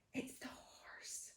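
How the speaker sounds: voiceless, slow hush; whispered answer. Real, not acted.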